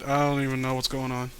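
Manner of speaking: ordinary talk, nothing marked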